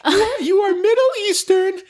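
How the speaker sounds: very high, thin woman's voice; high squeaky voice. Acted. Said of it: "reading ad in put-on voice".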